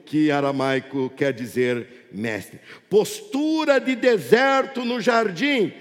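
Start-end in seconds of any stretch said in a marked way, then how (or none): 2.9-5.7 projected sermon with stretched key phrases; impassioned pulpit proclamation; fervent preaching. Real, not acted. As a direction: drive each phrase out to the congregation, drawing out the stresses. Preaches to congregation at full force.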